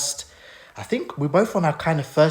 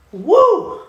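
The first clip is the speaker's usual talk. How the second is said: sudden high whoop to shake it off; letting the tears go with a whoop; release whoop; 5 dB louder than usual. Real, not acted. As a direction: shout the feeling out, very high. Shaking off the emotion.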